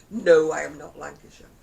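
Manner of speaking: loud mock protest; indignant Yorkshire person. Yorkshire person refuses to be Lancashire, mock indignant. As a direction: deny it loudly, stretching the No. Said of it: "a Yorkshire person would say".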